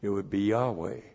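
"Yahweh" said as YAH-weh, stress on the first syllable; Yahweh pronounced. Shown as a demonstration. Preacher showing the congregation how the holy name would sound: YAH-weh. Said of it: "If you actually pronounced this".

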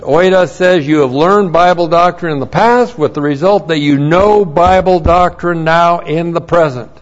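loud pulpit energy for a Bible class; preaching at full force. Acted. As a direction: push the grammar home like a sermon. Fired-up teaching of Greek grammar.

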